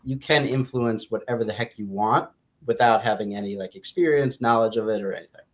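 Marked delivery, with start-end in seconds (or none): none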